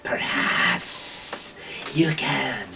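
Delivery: slow, drawn-out whispery creature voice; kobold toll-taker. Acted. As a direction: stretch out the demand in a kobold's half-whisper. Game master voices kobold demanding toll.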